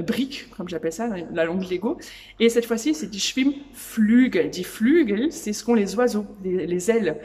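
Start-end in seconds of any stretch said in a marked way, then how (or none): 2.9-4.5 "Schwimmnudeln" said slowly and louder; teacher presenting a German word slowly and loudly; loud slow demo. Shown as a demonstration. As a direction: announce the new word slowly for learners. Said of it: "Je vais t'apprendre du vocabulaire".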